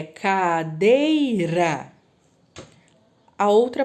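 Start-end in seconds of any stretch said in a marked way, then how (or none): 0.0-1.9 slow dictation. Shown as a demonstration. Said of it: "dictation to students".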